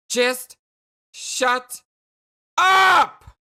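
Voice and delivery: high shout building to a peak; furious yell. Acted. Jane silencing Jake for good, furious yell. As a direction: separate words, exploding on the last.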